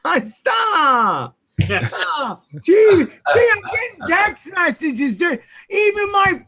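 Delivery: high, loud protesting cry; exasperated outburst. Real, not acted. Host begging co-hosts to stop, exasperated shout. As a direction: shout it out, overwhelmed by the teasing.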